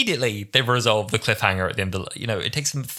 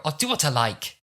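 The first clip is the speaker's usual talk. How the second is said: wrong take; 6 semitones higher than usual. Retold or imitated. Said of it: "Oh, that's not right".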